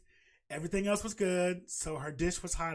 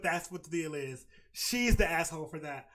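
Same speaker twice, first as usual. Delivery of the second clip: usual speaking voice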